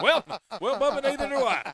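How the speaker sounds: high and loud, laughing through a mock country retort; bust out laughing while playing the bumpkin. Real, not acted. Cohost cracks back at the Korean guy joke, laughing loudly.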